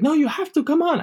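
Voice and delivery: high-pitched insistent imitation; pushy uncle. Uncle pressing reluctant nephew, high and insistent.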